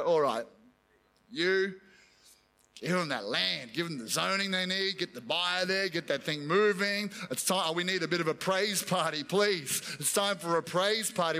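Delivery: a lightly voiced God granting the land; God relenting. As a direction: give in like a patient father.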